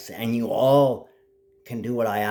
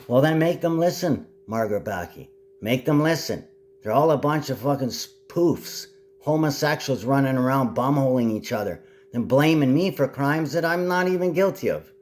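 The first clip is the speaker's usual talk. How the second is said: higher, louder furious tirade; angry rant; 5 semitones higher, 3 dB louder than usual. Real, not acted. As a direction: bark orders at the leaders. Ranting orders at world leaders.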